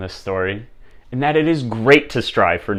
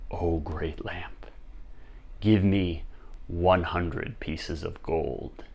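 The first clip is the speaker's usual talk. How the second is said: hushed, very slow, low invocation; contained excitement; 4 semitones lower, 11 dB quieter, slower than usual. Acted. King making his first wish to the lamp, hushed. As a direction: address it softly, slowly, as if in awe. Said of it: "was shaking with excitement".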